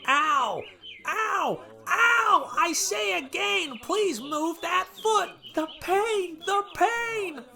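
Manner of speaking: very high, loud cries of pain, slowing on the plea; pained shouting. Acted. President begging Thomas to lift his foot, very high pained shouts. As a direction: yowl and beg him to move. President's tail still squashed.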